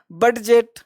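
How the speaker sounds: "बडजेट" with an audible d before j; d sounded. Shown as a demonstration. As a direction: say "बडजेट" as spelt, d then j. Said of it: "ऐसे नहीं पढ़ा है".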